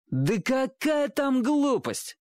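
put-on high, drawn-out boyish indignation; irritated exclamation. A narrator's reading. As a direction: scoff crossly in a kid's high voice. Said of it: "раздражённо воскликнул мальчишка".

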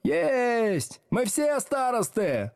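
high, drawn-out gleeful holler; jubilant yell. A narrator's reading. Back row mocking the teacher, jubilant high yell. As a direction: crow it as a rowdy chorus. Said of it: "ликующе завопила галёрка".

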